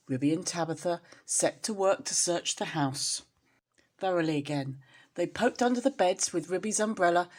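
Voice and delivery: usual speaking voice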